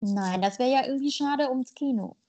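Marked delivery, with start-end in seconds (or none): none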